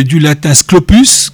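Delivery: ordinary talk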